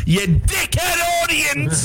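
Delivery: high-pitched, drawn-out jeer; jeering shout. Real, not acted. Jokingly insulting the audience.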